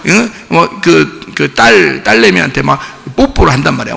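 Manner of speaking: ordinary delivery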